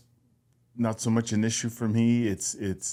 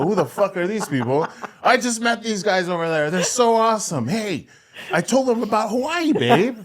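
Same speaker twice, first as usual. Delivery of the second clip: high, bubbly tipsy chatter, laughing through it; tipsy excitement; 9 semitones higher than usual. Retold or imitated. Tipsy wife gushing to partner about new friends, high and laughing. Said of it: "Noelle comes back with a group of people".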